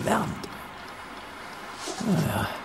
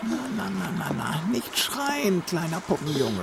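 soft hushing; 5 semitones higher, 6 dB quieter than usual. Acted. Captor hushes the screaming boy, softly sinister. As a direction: hush him like a child, menacingly.

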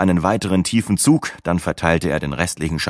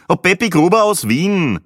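put-on high, loud old man's voice; Peppi Gruber; 13 semitones higher, 5 dB louder than usual. A narrator's reading. Older Viennese traveller introducing himself to the group, characterful. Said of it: "sagte der Mann mit dem Speckhut".

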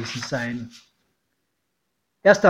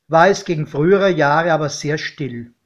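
plain reading voice

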